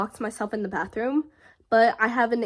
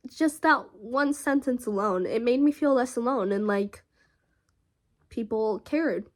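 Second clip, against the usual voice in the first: normal talking voice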